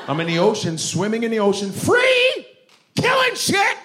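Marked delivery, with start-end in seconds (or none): 1.7-3.8 very high loud shout; exultant shout. Acted. The killer whale in the ocean, exultant shout. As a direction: yell it out wild and free. Whale yelling about freedom.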